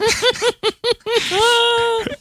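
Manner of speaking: high squealing laugh; real laughter at a silly name. Real, not acted. Laughing at listener name Poos.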